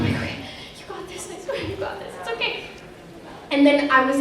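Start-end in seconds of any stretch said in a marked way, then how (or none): 0.0-2.6 high, much softer, hurried reassurance to herself; panicked self-pep talk. Retold or imitated. Kid actor psyching herself up before the monologue, panicky.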